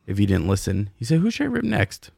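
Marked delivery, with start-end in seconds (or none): 1.2-2.1 high-pitched mock quote of a co-host; joking co-host imitation. Host retelling last episode, high joking imitation. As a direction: mimic him jokingly, pitched way up.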